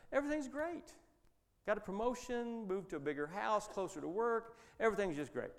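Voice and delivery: high, chipper list of good news; upbeat all-is-well. Retold or imitated. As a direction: put on a happy front. Friend bragging all is fine.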